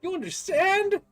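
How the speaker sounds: high, loud, flabbergasted professor voice; incredulous professor. Acted. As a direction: exclaim in disbelief, climbing to a drawn-out peak. Professor demands answer from fallen stranger.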